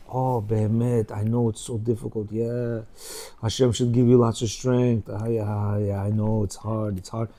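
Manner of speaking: sympathetic husband. Retold or imitated. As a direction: hush it down and sound truly sorry for her.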